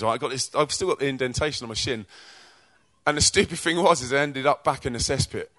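normal talking voice

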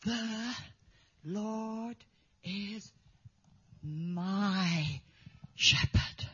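The, Lord, is, my, shepherd, each word apart with long pauses, soft; halting, word by word, soft; boy's halting recitation. Retold or imitated. The boy showing his faith, halting word by word. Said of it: "He went like this".

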